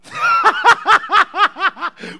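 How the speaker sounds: very high, loud cackling peal; helpless shrieking laughter; uproarious laugh. Real, not acted. Preacher overjoyed by an offering, uproarious high laugh.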